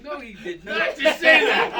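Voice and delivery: high, loud and drawn-out incredulous outburst; loud high-pitched retold shock; stunned disbelief. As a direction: exclaim it slowly as if you cannot believe it. Retold stunned reaction to coach's invitation.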